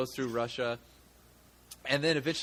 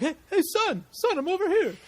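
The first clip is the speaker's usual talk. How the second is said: much higher, goofy Kevin James-style dad calling out to his son; bumbling dad calling out; 5 dB louder than usual. Retold or imitated. Clumsy dad interrupts a CIA extraction, high goofy call to his son. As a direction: call out like a clueless, eager dad. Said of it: "picture Kevin James".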